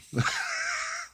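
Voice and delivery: short high-pitched laugh; quick squeaky laugh. Real, not acted.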